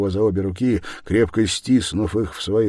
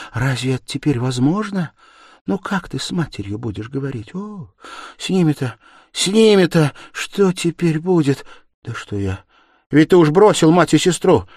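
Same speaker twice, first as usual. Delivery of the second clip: high lament building to loud; distraught crying out; 12 semitones higher, 9 dB louder than usual. A narrator's reading. Sonya lamenting his mother and sister, distraught. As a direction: let the worry swell into an outcry. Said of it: "вскрикнула она".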